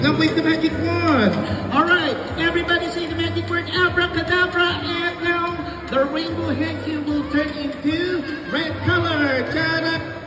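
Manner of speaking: put-on rally the kids like a party magician. Magician leads the magic word.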